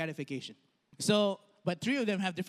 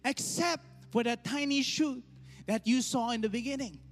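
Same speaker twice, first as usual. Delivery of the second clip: rising sermon delivery; 5 semitones higher than usual. Acted. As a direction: preach it with climbing pitch. Builds sermon illustration.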